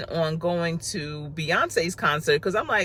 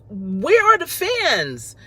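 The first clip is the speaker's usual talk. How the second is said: incredulous; 7 semitones higher, slower than usual. Retold or imitated. Asking for fans, high and slow.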